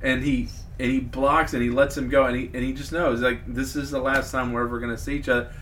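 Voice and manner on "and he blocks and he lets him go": normal talking voice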